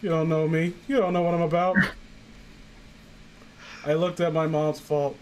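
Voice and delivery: ordinary talk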